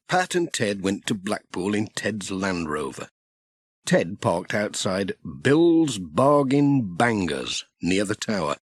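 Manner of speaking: plain reading voice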